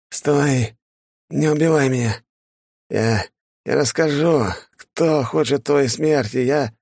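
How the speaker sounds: высоко и отчаянно, умоляя о жизни; голос раненого, молящего не убивать; испуганная мольба. Acted. Раненый колдун молит о пощаде. (умоляющий испуганный голос)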